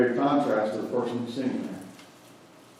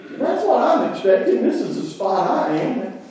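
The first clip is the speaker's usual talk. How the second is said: mock indignation; 9 semitones higher, 3 dB louder than usual. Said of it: "He's mad and angry".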